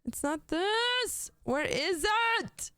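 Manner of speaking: a high, slow whine while hunting for a Lego piece; high whiny wail. Acted. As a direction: whine it up high like a frustrated kid.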